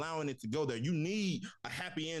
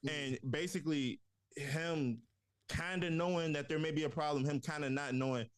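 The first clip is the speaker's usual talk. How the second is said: ordinary talk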